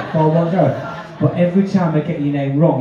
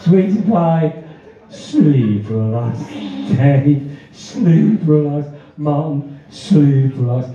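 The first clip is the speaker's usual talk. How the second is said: hypnotist induction; 3 semitones lower, much slower than usual. Pretend. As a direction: lull them under like a stage hypnotist.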